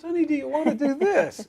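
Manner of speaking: high, sugary innocent husband voice; mock innocent sweet tone. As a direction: pretend the tone was perfectly sweet. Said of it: "his comeback is".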